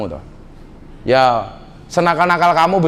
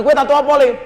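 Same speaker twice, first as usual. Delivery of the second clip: higher, louder, playful put-on voice; teasing question; 10 semitones higher, 3 dB louder than usual. Preacher asking a fat man about his belly tattoo, teasing high voice. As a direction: tease the big man from the stage.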